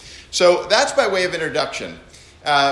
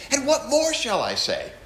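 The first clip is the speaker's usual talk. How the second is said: 1 excited; 7 semitones higher than usual. Shown as a demonstration. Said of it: "there's excitement".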